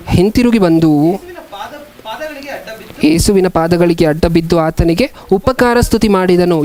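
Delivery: slow, measured Bible reading; scripture reading. A narrator's reading. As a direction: read it out phrase by phrase, with long pauses. Reads scripture aloud. (ನಿಧಾನವಾದ Bible ವಾಚನ)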